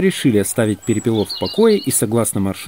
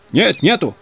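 higher, called-back denial; shouted answer; 7 semitones higher than usual. A narrator's reading. Кардамонов answering from the other troika, calling out. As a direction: call back from another carriage. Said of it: "крикнул Кардамонов".

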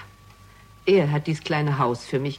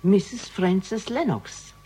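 slow repeated name; 4 semitones higher, much slower than usual. Acted. Repeats name after "Bitte?.

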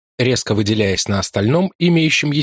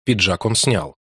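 plain reading voice

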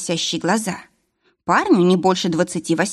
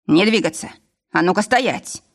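ordinary delivery

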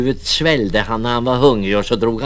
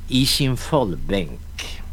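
ordinary delivery

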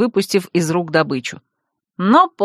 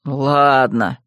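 low regretful grumble; pitched-down, grudging assent; reluctant grumble; 7 semitones lower than usual. A narrator's reading. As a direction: give in with a sigh of regret. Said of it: "проворчал Бер со вздохом сожаления".

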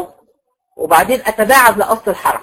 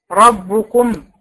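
ربكم said slowly, ending on a sukun meem; teacher adds the silent meem to ربكم, slowly; slow, with final meem; much slower than usual. Shown as a demonstration. ربكم بميم ساكنة. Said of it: "وبعدين أجي على الميم".

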